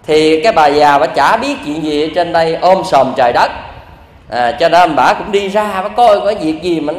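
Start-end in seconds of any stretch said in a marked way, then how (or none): none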